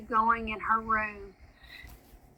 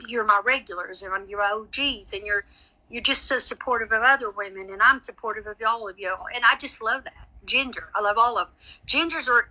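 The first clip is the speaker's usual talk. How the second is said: normal talking voice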